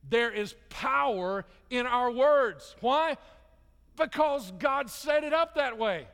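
high, driving sermon delivery; full-force preaching. Acted. Preacher urging congregation to speak faith, hope and peace, full-force preaching. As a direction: preach it hard, stretching the key words.

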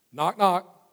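crisp playful knock knock. Shown as a demonstration. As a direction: announce it like a knock at the door. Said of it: "I'm gonna show you how easy it is".

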